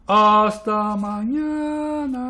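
high, very slow goodbye; long-held farewell. A narrator's reading. Blot calling a last goodbye, long-held. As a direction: hold out "hasta" and "mañana" at length. Second goodbye, much longer.

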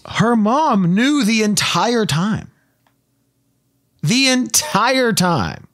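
high, stretched stress on "entire time"; emphatic reveal. Real, not acted. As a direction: land the reveal slowly, leaning hard on "entire". Storyteller's big reveal, repeated for effect.

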